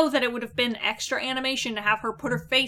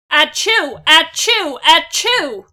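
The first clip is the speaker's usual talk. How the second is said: fake sneeze; 4 semitones higher, 9 dB louder, slower than usual. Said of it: "sneeze sound effect".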